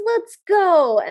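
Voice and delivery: loud, eager call to go; eager excitement. Retold or imitated. Prince leading Cinderella into the ball, eager and loud. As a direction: burst out with it, raring to go.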